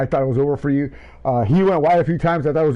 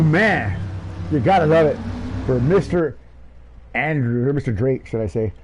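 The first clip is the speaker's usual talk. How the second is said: ordinary talk, nothing marked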